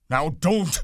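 high, loud panicky command; angry. A narrator's reading. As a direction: snap it in frightened anger. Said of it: "Then Lenny grew angry".

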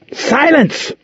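commanding shout. A narrator's reading. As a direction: cut him off hard. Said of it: "she interrupted".

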